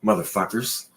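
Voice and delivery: ordinary talk, nothing marked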